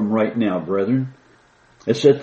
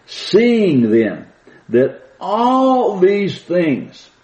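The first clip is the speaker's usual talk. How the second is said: dramatic pulpit reading, drawn out and high; slow, high scripture reading; 9 semitones higher, 4 dB louder, much slower than usual. Acted. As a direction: lift the verse up slowly, like a proclamation. Preacher reads scripture aloud.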